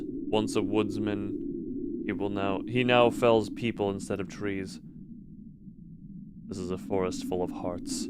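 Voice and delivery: low, even storyteller read; story narration. Acted. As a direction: read it like a dark fairy tale.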